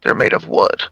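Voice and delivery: low, hushed whisper. Acted. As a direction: whisper it to an ally as a secret. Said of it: "I'm just gonna whisper to him".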